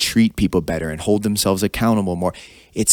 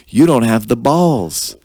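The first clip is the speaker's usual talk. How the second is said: retold taunt; 6 dB louder, slower than usual. Calling out the new leader.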